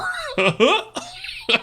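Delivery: high, silly put-on laugh. Streamer laughs at own tongue twister, silly high laugh. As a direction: laugh in an exaggerated, unnatural way. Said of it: "I shouldn't laugh like that".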